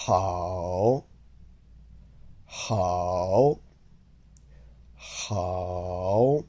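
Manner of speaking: "好" drawn out low, rising at the end; careful Mandarin "好" with a long dip; third tone. Shown as a demonstration. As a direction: sink low on "好", then lift it. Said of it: "learn how to say good".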